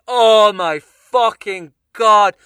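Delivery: loud, slow contempt; disgusted. Retold or imitated. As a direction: spit out the scorn. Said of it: "And I just think".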